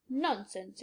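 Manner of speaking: a low, lightly voiced scoff; scornful disbelief. A narrator's reading. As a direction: brush it off as an old man. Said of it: "exclaimed the doctor with scornful incredulity".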